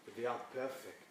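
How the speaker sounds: ordinary talk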